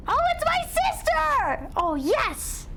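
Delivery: high, stretched-out gushing; hammed-up high-pitched excitement; mock delight. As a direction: squeal it slowly with exaggerated joy. Pretend delight at seeing sister.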